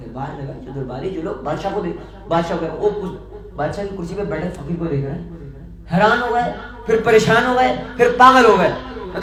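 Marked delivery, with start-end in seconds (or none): 5.9-8.7 rising louder from shocked to frantic; building alarm. A narrator's reading. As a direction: build it up step by step, louder each time. Courtiers' growing shock. (حیرت سے پاگل پن تک بڑھتی ہوئی آواز)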